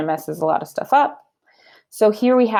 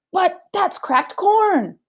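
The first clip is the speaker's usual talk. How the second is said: much higher, slow, aghast wife; shocked Missus Rogers; 11 semitones higher, much slower than usual. Acted. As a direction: drag it out in pure disbelief. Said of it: "said Missus Rogers".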